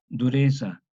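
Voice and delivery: dureza with a buzzing voiced z, like Italian zio; voiced old Spanish z. Shown as a demonstration. Teacher modelling old Spanish dureza. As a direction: buzz the z in dureza. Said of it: "se pronuncia como una cuasi D S".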